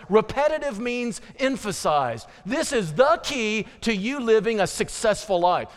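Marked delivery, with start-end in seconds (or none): none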